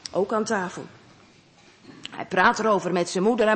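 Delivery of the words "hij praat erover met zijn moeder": usual speaking voice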